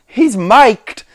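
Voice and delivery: usual speaking voice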